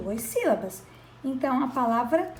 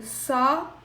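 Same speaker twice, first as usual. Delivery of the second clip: "só" held apart, pause before the final u of sol; wrongly split word. Shown as a demonstration. Teacher showing what one cannot do, "só" cut from its ending. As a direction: break sol off after "só". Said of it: "Eu não posso fazer isso aqui".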